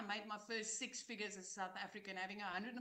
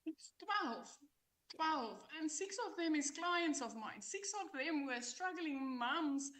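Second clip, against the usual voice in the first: excited; 6 semitones higher, 3 dB louder, slower than usual. Real, not acted. Excited reveal of millionaire count.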